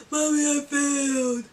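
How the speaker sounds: whimpered confession to mom, imitated; mock crying. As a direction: wail it like a tearful child, hammed-up. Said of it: "and I'm just crying".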